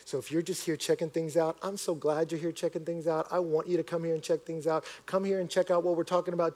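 usual speaking voice

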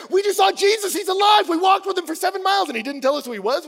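high, loud breaking news; thrilled eyewitness spilling the story at high pitch; excited announcement. Acted. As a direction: burst in shouting the astonishing news. Disciples burst in with news.

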